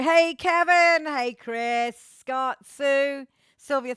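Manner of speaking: big drawn-out hello to a fan; shouted hello across the ocean; loud greeting. Real, not acted. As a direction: call out to him warmly. Greeting far-away fans by name.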